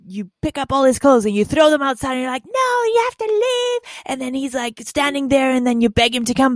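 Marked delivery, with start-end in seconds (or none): 2.5-3.8 high mock yell. Throwing his clothes out, she yells at him in a high mock yell. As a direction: throw the words at him, pitched way up.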